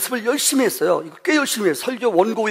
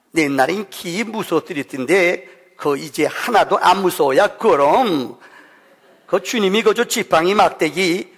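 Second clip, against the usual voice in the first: Pyeongan dialect. Pretend. Pastor reads psalm for his father in Pyeongan dialect. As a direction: read it like a North Korean grandfather asking questions. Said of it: "평안도 버전".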